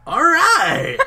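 hammed-up high cheering yell; laughing mock whoop; excited shout. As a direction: belt it out like a wrestler celebrating. Retelling Stone Cold's cheer.

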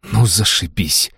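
slow, breathed-out indignation; exasperated sigh. Acted. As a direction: sigh it out, annoyed. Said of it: "выдохнула Алиса, не скрывая своего негодования".

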